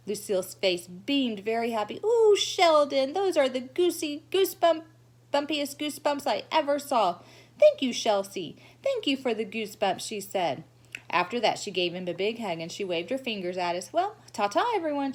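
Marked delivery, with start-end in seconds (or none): 2.0-10.0 put-on delighted showy girl. Lucille beaming and cooing her thanks to Sheldon. 13.9-15.1 high airy little wave-off; airy goodbye. Acted. Lucille waving her fingers goodbye to the class, airy.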